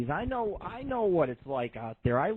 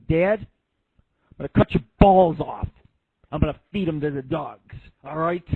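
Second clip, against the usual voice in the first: put-on mafia kid; 8 dB louder than usual. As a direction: drag out the son's gangster threat. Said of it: "he starts talking like he's the mafia".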